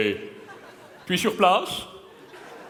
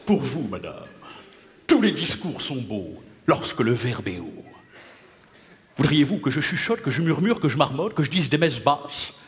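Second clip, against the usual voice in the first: theatrical tirade; 3 semitones lower than usual. Acted. Dramatist answering madame with a grand tirade, low and declaimed. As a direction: declaim it like classical verse. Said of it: "il me vient une tirade".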